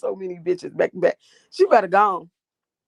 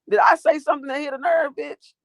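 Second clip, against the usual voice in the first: taunting shout; 7 semitones higher than usual. Real, not acted. Taunting a heckler.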